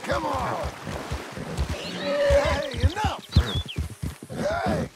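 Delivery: high, loud yells at a horse; shouting. Acted. Yelling at a bucking horse.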